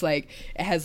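normal talking voice

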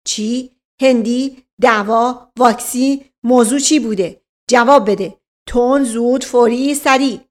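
loud, punched word-by-word questions; bullying demand for an instant answer; rapid-fire interrogation. Acted. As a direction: pounce on each word like an inspector. Inspector pounces with questions.

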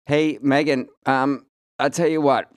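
high, loud mock British Prince Harry voice; a hammed-up posh English royal on the phone; British accent impression. [name] doing Harry calling him, British accent. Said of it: "my accent falls apart".

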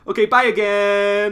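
loud, high sing-out goodbye; mocking farewell. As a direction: call it out in a mocking imitation. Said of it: "Then he's like".

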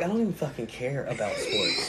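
tossed off through a laugh; laughing, quiet aside. Retold or imitated. Telling the crowd she doesn't care about sports, softly laughing. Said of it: "And I was like".

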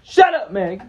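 high, very loud heckle; yelling while walking away. Acted. Heckler walking away from actress, loud mock yell. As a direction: keep hollering it as a parting shot. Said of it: "still yelling it".

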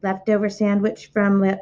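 plain reading voice

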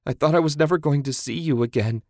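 high, rushed distraught wail; tearful wail. Acted. Woman reunited with the man she thought lost, wailing. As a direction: let it pour out fast, wailing. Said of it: "she wailed".

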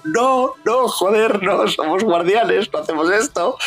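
hammed-up flustered hero; exasperated protest. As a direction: plead with a trigger-happy teammate. Star-Lord protesting in retold scene.

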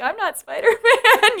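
high laughing squeak of a line; mock Peter voice. Friend imitating Peter's denial, high and giggly. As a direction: play a nervous kid, way up high.

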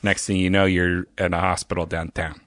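hammed-up Pittsburgh local; Pittsburgh accent. Shown as a demonstration. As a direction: lay on a thick Pittsburgh accent. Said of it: "That's a good accent".

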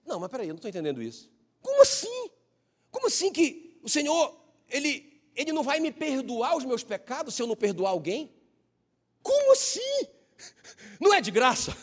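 high, incredulous protest, rising into near squeaks; mock bewilderment on "Como assim?"; baffled young believer. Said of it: "aí eu ia lá e dizia".

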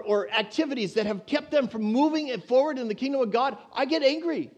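high, loud, impassioned preaching; preaching at full force. Acted. Preacher pressing the church on mercy, full force. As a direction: drive it home to the congregation.

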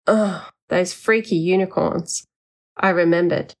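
plain reading voice

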